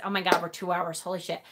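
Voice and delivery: dropped low under her breath, caught off guard; a shocked mutter to herself; startled aside. Real, not acted. To herself, noticing the stream hit two hours, a startled quiet aside.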